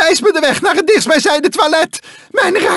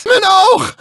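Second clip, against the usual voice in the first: pained cry; 6 semitones higher, much slower than usual. Acted. Character hit in the eye, crying out. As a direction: cry out, clutching the hurt eye.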